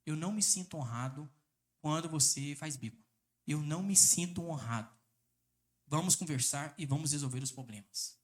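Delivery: low, soft, gentle tone; calm soft statement. Shown as a demonstration. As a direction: say it quietly without pushing. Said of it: "Mostre pra ela".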